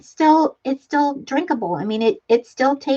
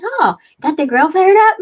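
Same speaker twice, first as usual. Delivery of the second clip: higher, louder cheery neighbor calling over the fence; mock nosy neighbor; 7 semitones higher, 5 dB louder than usual. Host playing the neighbor who shows up for food, chirpy and loud. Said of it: "I'd be like".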